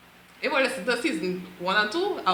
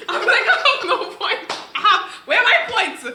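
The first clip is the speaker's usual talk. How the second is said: high and laughing; 9 semitones higher than usual. Real, not acted. Laughing at being out-argued.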